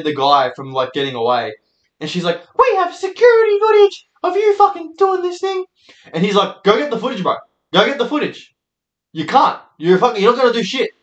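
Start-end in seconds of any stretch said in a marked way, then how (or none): 2.6-5.6 very high screeching guard impression; mock yelling. Mimics the yelling security lady. 6.6-10.9 higher-pitched cocky dare; taunting. Retold or imitated. Guy taunts the guard.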